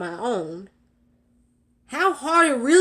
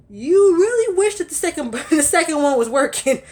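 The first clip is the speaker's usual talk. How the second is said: exasperated laugh, higher; 6 semitones higher, 4 dB louder than usual. Real, not acted. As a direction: laugh at the memory.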